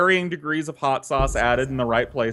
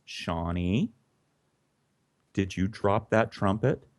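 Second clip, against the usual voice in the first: low, soft, measured questioning; lightly voiced mother, pausing before the question; stern quiet mom; 3 semitones lower, 6 dB quieter, slower than usual. Mom kneels to question her son, quietly stern.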